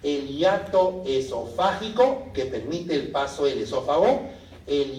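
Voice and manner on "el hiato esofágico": ordinary talk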